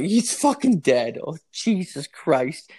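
high, slow, stunned panic; realizing a friend is dead, aghast; horrified panic. Retold or imitated. Finding the roommate in bed, horrified.

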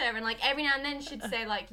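ordinary talk, nothing marked

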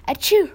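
sharp high sneeze burst; a sudden loud sneeze. A narrator's reading. Mom sneezes mid-reading.

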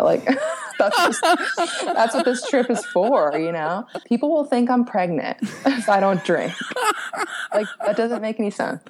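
real laughter breaking through the words; laughing while talking. Real, not acted. To the host, laughing at her old excuse about drinking.

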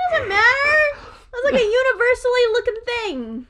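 high, loud indignant protest. Real, not acted. Defending a silly drawing idea to a friend, high-pitched protest. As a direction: pitch it way up in comic exasperation.

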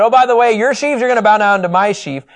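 high, loud bragging; mock smug brag; boastful teen. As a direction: lord it over the brothers. Young Joseph boasting to brothers.

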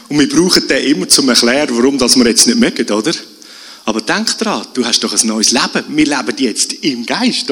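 ordinary talk

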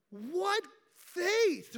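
usual speaking voice

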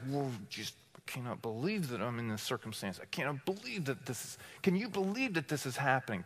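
hushed, hurried complaint under your breath; passive-aggressive grumbling. Acted. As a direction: grumble it softly and fast, as if not quite to them. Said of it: "under your breath ... passive aggressively".

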